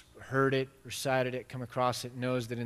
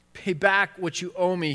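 louder, higher demanding snap; a servant choking a debtor and demanding payment; angry demand; 5 semitones higher, 8 dB louder than usual. Retold or imitated. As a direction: throw the debt in his face. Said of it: "he demanded".

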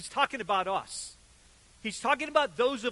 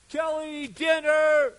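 high, drawn-out call across the neighborhood; mom yelling; 7 semitones higher, 6 dB louder, much slower than usual. Retold or imitated. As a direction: call the kid in to dinner, loud and high. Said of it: "you hear your mom yell".

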